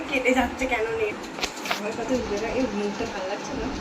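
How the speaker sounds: ordinary talk, nothing marked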